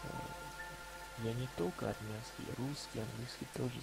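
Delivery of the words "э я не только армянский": ordinary talk, nothing marked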